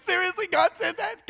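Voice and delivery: can't believe what he just heard; stunned disbelief. Retold or imitated. Retelling how God spoke to him, stunned and high. Stunned reaction to God's message.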